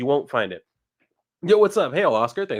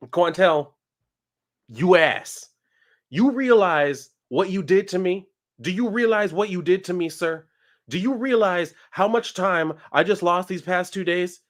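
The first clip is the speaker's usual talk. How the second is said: mock scolding; 6 semitones higher than usual. Mock-scolding a chat friend.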